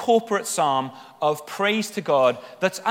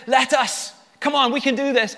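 rallying encouragement; 8 semitones higher, 4 dB louder than usual. Acted. Acts out people encouraging each other.

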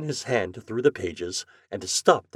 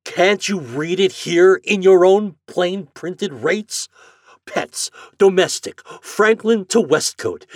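loud, higher, exasperated; angry customer; 12 semitones higher, 9 dB louder than usual. Acted. Morehouse arguing with the agent, exasperated and loud. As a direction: hammer each point home.

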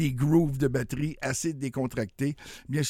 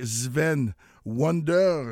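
slow announcer name; 3 semitones higher, 3 dB louder, much slower than usual. Acted. Radio host announcing artist name.

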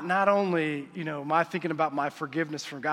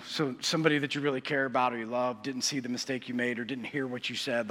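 ordinary delivery

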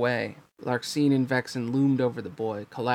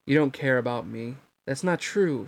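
ordinary delivery

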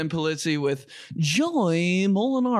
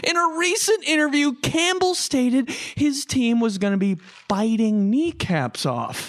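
high, bright sportscast read; news anchor voice; 9 semitones higher, slower than usual. Pretend. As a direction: deliver it like a cheesy TV anchor. Spoof news read continues.

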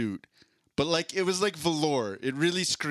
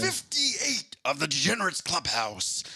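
ring announcer voice; 4 semitones higher than usual. Acted. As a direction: stretch every word like a boxing announcer. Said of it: "I'm doing my ring announcer voice".

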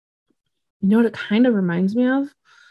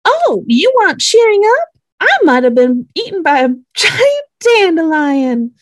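high, loud hammed-up character voice; exaggerated high-pitched imitation; Waverly; 10 semitones higher, 6 dB louder than usual. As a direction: put on a piercingly high mock voice, breaking into a laugh. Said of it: "Waverly voice. And she goes".

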